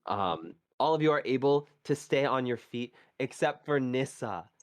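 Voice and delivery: scene-setting storyteller voice; game master narration. Acted. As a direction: set the scene for the players. GM describes the battle scene.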